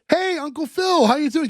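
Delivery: high-pitched, eager hello; imitating an exuberant greeting; excited greeting. Acting Will greeting his uncle.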